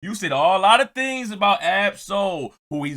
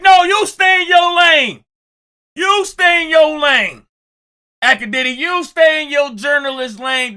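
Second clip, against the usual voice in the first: loud, high barking rebuke; imitated shout; 9 semitones higher, 7 dB louder than usual. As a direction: yell it like a street elder.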